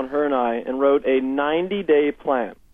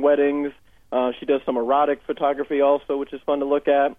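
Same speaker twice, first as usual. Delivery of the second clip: ordinary delivery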